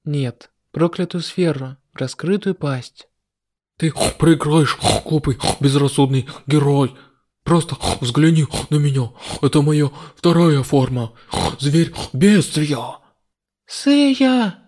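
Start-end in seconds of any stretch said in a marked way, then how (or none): 3.8-13.0 menacing villain. Acted. Demon taunting hero. 13.6-14.6 high, loud worried cry; anxious shout. Acted. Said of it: "Я тревожно закричала".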